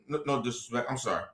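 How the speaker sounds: hushed self-correction; quick apology aside. Real, not acted. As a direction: tuck the apology in quietly. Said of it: "quick apology aside".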